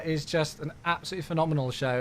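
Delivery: ordinary delivery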